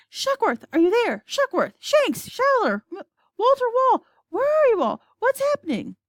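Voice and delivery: high, loud calling out of names; frantic calling. Acted. President calling the missing crew over the radio, frantic. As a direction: call into the radio, desperate for an answer.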